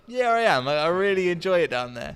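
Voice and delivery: mock-eager, lifted voice; retold keen reply. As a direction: act out his eager agreement. Acts out friend's keen reply.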